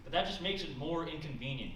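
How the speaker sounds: normal talking voice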